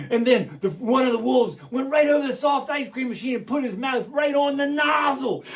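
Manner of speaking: ordinary delivery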